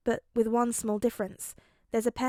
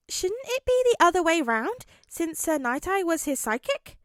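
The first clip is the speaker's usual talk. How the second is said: put-on high girl voice; 7 semitones higher, 5 dB louder than usual. Hando teasing question.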